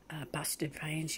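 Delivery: slow hushed whisper. Real, not acted. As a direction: drop to a secret whisper. Ominous confession.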